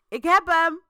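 loud, high triumphant shout; a cop bellowing that he's got him; agent shouting. A narrator's reading. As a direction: yell it across the yard. Agent shouts from back door.

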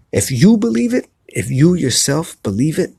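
ordinary talk, nothing marked

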